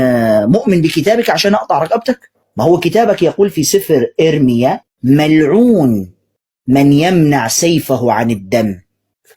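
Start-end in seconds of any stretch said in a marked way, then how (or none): 5.0-8.8 scripture reading. Acted. Reciting scripture slowly.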